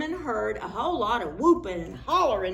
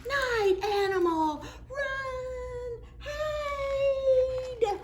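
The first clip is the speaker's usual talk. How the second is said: high shouted panicky cry; panicked cry; much slower than usual. Acted. Animals shouting to hide in fear. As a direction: scream the warning.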